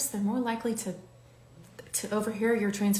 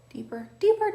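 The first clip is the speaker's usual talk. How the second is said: sing-song high; 13 semitones higher, 4 dB louder than usual. Shown as a demonstration. Repeats the shade name playfully.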